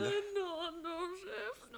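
tearful pleading. Acted. Wife tearfully begging her husband not to leave. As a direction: plead through tears. She begs him to stay.

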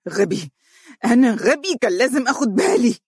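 angry shout at his own stupidity; furious self-blame. Acted. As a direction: spit it at yourself in rage. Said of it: "يهتف حانقا".

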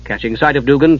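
usual speaking voice

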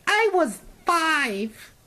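very high, drawn-out squeal; mock listener outburst. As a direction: squeal it slowly like an indignant youngster. Mimicking a young listener's reply.

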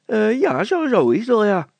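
put-on high, loud childish voice; a cheeky little girl piping up; Petronella the girl. Girl answering the mayor, high and childish.

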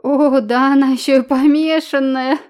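mocking laugh, high and loud. Dikon jeering at the girl who calls herself princess, loud laughing. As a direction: laugh her claim off. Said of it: "хохотал".

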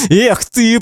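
high-pitched scornful exclamation; contemptuous. A narrator's reading. Man repeats his scorn while breaking free, contemptuous. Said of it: "повторяет он".

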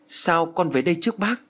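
high, flustered stammer; stammering question. A narrator's reading. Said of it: "lắp bắp hỏi".

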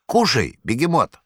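high, mock-hospitable wheedling voice; Korovyev's invitation. A narrator's reading. Korovyev offering the cat a treat, high sly coaxing. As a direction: coax the cat to eat like a sly host.

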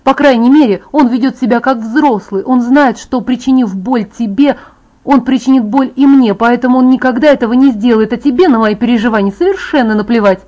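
loud, high, angry tirade; shouting. A narrator's reading. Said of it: "что уже кричу".

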